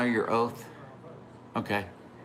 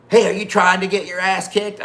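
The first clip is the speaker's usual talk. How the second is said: high, loud mock tough guy; imitated threat; 8 semitones higher, 6 dB louder than usual. Passerby threatening the parked rider, loud imitated challenge. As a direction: imitate a man picking a fight, pitched up.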